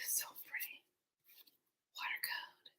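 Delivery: breathy admiring whisper. Real, not acted. Showing the card backs, a soft whisper. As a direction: whisper over the cards.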